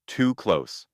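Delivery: ordinary delivery, nothing marked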